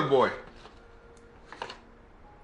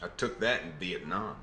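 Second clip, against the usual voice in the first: lower-pitched put-on Southern American accent; 5 semitones lower, 5 dB quieter than usual. Showing shrapnel from Vietnam, low Southern accent. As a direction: drop low and play it Southern. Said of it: "putting on a Southern accent".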